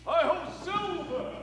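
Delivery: high ringing call to the horse; rallying cry. Acted. Masked rider calls his horse, high ringing cry. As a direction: throw the call out as you ride off.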